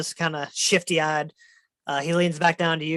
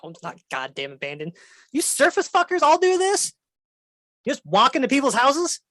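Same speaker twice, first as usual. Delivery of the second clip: grumbling then shrieking dragon; indignant dragon; 8 semitones higher than usual. Acted. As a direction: mutter then shriek in outrage.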